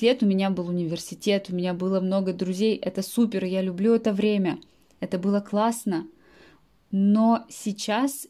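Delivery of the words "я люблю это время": normal talking voice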